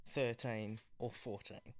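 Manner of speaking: ordinary talk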